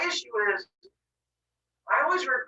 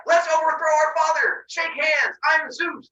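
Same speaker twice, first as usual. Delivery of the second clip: high, loud gung-ho introduction; mock eager greeting; 9 semitones higher, 5 dB louder, slower than usual. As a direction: introduce yourself with overblown enthusiasm. Imagined eager greeting to siblings.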